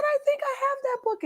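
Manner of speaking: very high, wondering reader; hesitant fan. Retold or imitated. Imitates fan slowly recognising her.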